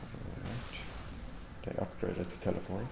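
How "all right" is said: ordinary talk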